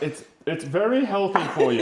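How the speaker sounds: jokey, laughing through it. Real, not acted. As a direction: say it laughing at the joke. Joking about healthy dessert.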